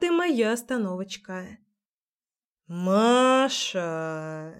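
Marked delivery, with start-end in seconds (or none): 2.7-4.5 low hoarse bass shout; hoarse bass call. Acted. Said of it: "Хриплый бас эхом разлетелся".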